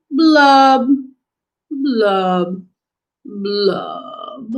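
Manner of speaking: drawn-out blubs, each one sinking; gloomy fish blubs. Acted. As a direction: blub mournfully, fading away step by step. Voices the fish's gloomy blubs.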